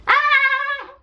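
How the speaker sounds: high shrieking villain cackle; piercing evil laugh, hammed up; witch cackle. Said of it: "Bellatrix laughed".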